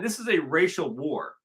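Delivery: usual speaking voice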